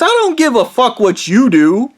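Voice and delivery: high-pitched, heated comeback; retold defiant retort. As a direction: fire back as in the remembered row. Retelling his defiant retort.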